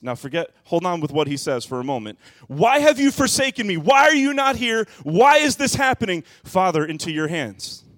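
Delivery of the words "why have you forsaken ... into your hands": much higher and louder, crying out to the Father; a desperate shout that ends in surrender; anguished cry. Acted. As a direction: cry out in agony then settle. Acting Jesus' cry on cross.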